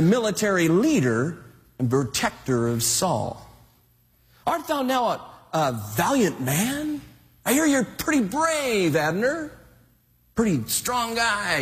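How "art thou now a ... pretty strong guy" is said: higher, teasing mock praise; playful taunting call; mild taunt. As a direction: needle him playfully across a distance. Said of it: "have a little fun with Abner".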